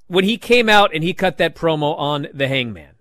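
normal talking voice

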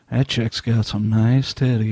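low, slow leering comment; lecherous aside. Retold or imitated. Husband teasing his wife during her show, low and leering. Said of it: "It's like".

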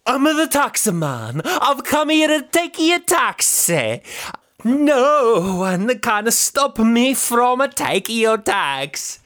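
put-on high, slow, hammy Italian accent; booming stage Italian villain. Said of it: "I've worked on my Italian accent".

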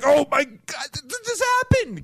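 high shouted joy, stammering; ecstatic yell. Retold or imitated. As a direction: scream it with joy while shaking the wheel. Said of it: "shaking the car, like".

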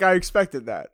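ordinary talk, nothing marked

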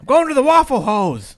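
loud joking declaration; booming, playful proclamation; mock announcement. Mock quote of a restaurant-goer.

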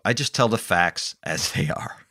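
ordinary talk, nothing marked

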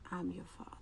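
whispered softly, almost no voice; a reverent whispered recollection; hushed whisper. Retold or imitated. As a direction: breathe God's words in a whisper. God's words recalled in awe.

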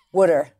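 wooder slowly, OO vowel for water; Delco "wooder". Shown as a demonstration. Correcting the interviewer, slow Delco wooder. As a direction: insist on wooder, drawn out. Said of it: "No, wooder".

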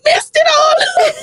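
high-pitched playful whine; mock exasperated protest. Girlfriend explaining the joke to her boyfriend, mock exasperated.

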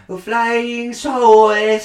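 put-on high, slow Japanese accent; drawn-out Japanese accent imitation. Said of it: "I'm gonna do my".

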